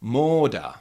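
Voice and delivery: Moda with a drawn-out first O, falling at the end; long O demonstration. Shown as a demonstration. Teacher for learners to repeat, Moda with a long O. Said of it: "you can repeat after me".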